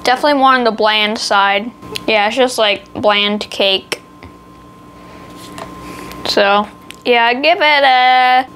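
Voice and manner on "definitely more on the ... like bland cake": talking with mouth full; chewing cookie while talking. Real, not acted. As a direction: hand over mouth, chewing. Seen 0: Holds cookie at lips, then hand over mouth while talking and eating.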